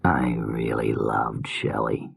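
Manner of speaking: low, slow slurred murmur. Acted. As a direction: slur the words softly. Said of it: "he said in his slurred voice".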